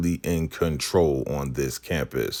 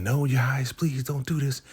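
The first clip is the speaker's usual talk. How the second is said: high-pitched, increasingly rushed mock pleading; imitated begging; 12 semitones higher, faster than usual. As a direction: beg urgently in a put-on high woman's voice. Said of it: "And she's begging".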